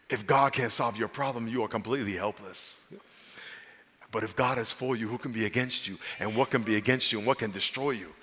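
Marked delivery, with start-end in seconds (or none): none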